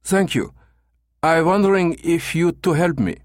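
put-on halting foreigner's English in a German accent; broken English, German accent. A narrator's reading. Caller asking agency for help, thick German accent. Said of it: "continued in broken English".

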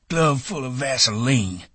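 slow, high-pitched sneer of disgust; mocking old man's disgust. A narrator's reading. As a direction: draw it out high with distaste. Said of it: "he said disgustedly".

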